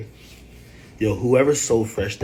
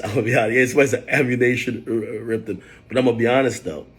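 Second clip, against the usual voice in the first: ordinary talk, nothing marked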